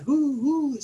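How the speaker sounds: hooting "Who, who" like an owl. Pretend. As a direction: hoot "Who, who" high like an owl. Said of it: "I feel like an owl when I do that".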